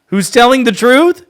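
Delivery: high loud mocking repeat of the question; mocking shout. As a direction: throw his question back, loud.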